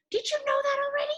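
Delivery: chirpy quiz; eager puppet question. Acted. Puppet quickly quizzing the kids about rainbows.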